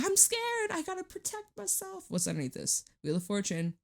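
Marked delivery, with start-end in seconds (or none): 0.0-2.1 teasing the listener with a tiny scared voice; mock scared voice. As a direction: squeak it like a frightened little kid. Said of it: "You're like".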